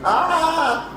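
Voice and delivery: startled yelp. Real, not acted. Startled by hot extractor fan.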